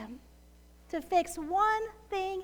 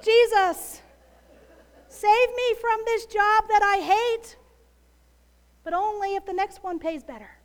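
mock bargaining prayer; 3 semitones higher, 7 dB louder, faster than usual.

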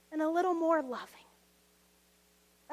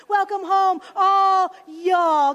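loud Southern drawl, Jesus greeting all y'all; Southern twang welcome; 3 semitones higher, 13 dB louder, slower than usual. Retold or imitated. Jesus welcoming everyone home in a loud Southern twang. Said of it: "he probably's got a good Southern twang".

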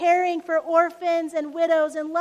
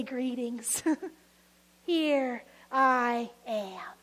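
gentle Jesus greeting; 3 semitones lower, much slower than usual. Pretend. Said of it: "He'll show up and say".

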